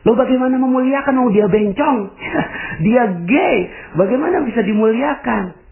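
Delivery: incredulous objection. Retold or imitated. Mock objection of listener.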